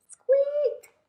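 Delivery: very high squeak; mouse squeak. Acted. The hidden mouse squeaking at Owl, high and tiny.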